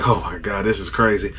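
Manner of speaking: low, soft disbelief; hushed awe. Retold or imitated. Angler reliving his first-hour haul, hushed disbelief. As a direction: murmur it, stunned. Awed at catch.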